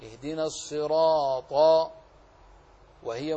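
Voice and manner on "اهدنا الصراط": الصراط with a full, heavy emphatic ra; الصراط said with tafkhim on the ra. Shown as a demonstration. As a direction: make the ra in الصراط thick and dark. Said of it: "الراء مفخمة".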